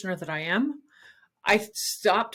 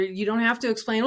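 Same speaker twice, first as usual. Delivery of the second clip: ordinary talk, nothing marked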